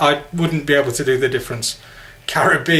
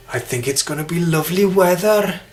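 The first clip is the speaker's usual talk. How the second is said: higher, soft Welsh lilt; soft Welsh accent; 4 semitones higher than usual. Shown as a demonstration. Showing how soft Welsh sounds, soft Welsh accent. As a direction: let the Welsh tune rise gently. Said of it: "It's soft".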